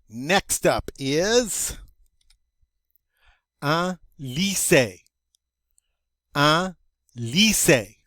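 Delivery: slow "un lycée" with the French y sound; teacher slowly modeling "un lycée"; model French word. Shown as a demonstration. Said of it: "I'm going to say, um, the word in French".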